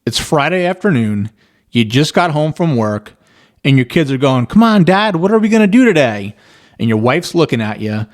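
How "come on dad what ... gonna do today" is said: put-on higher, whiny kid pleading with dad; begging kid. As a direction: play an eager kid nagging his father.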